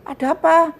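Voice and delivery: loud call through a closed door. Retold or imitated. Singer calling loudly through the hotel door. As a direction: call out who is knocking.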